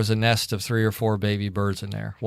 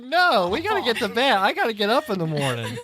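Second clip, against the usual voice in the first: high-pitched retold protest; exasperated refusal; 10 semitones higher than usual. Tired husband refusing wife at bedtime, mock exasperated. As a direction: plead for sleep, hammed-up exasperation.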